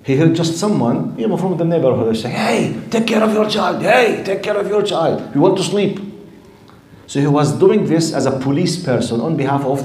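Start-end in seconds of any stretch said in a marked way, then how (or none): 2.3-6.0 high-pitched mock shout at a parent; mock neighbour's shout. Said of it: "he shouted from behind the wall".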